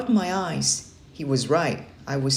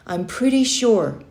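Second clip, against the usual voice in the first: nervous; 6 semitones higher, 4 dB louder than usual. Acted. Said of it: "even though I felt nervous".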